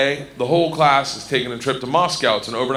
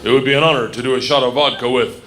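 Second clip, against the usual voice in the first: low, reverent Russian official; solemn conductor; 6 semitones lower than usual. Acted. Train conductor honouring a student guest, low and solemn. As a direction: pay homage in a low, grave voice. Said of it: "The conductor walked in".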